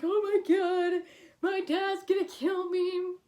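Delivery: panicking. Retold or imitated. Retelling the moment of dreading her dad's anger, mock panicked. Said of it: "So I'm here panicking".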